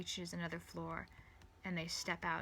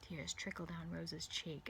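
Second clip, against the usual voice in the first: ordinary delivery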